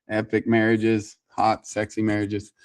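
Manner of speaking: ordinary talk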